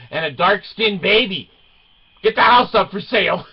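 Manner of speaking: high, loud scandalized onlookers; mock alarm. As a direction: call out in hammed-up panic. Imitates alarmed neighbours driving by.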